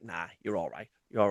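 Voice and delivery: softly dismissive; dismissive mutter. Retold or imitated. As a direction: brush it off under your breath. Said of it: "I'll be like".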